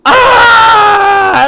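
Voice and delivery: high, loud cry of pain; mock scream of a spirit cooked in steam. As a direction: scream as you get cooked alive. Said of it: "and he goes".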